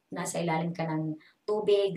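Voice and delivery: usual speaking voice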